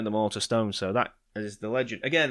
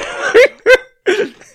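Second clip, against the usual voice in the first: high, loud hammed-up cackle; giddy shocked laughter; mock shrieking laugh; 15 dB louder than usual. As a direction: let out a squealing old-lady laugh. Imitating sitter after shock.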